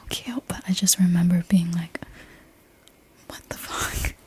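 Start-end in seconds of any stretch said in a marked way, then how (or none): 3.3-4.1 soft whispered retold shock; hushed disbelief. Remembering waking beside the baby crab, hushed disbelief. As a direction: whisper it, baffled.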